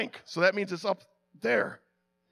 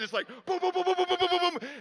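pounding booms; 9 semitones higher, faster than usual. Retold or imitated. Pipe pressure pounding the Teflon.

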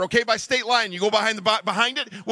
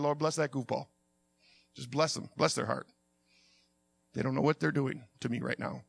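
very soft, low murmur; restrained patient prayer; 9 semitones lower, 9 dB quieter than usual. Retold or imitated. Driver blessing a goofball, hushed restrained prayer. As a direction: pray it quietly to keep your calm.

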